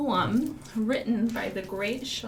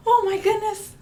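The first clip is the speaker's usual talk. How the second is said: mock surprise; 12 semitones higher, 6 dB louder than usual. Gasp at the puppet.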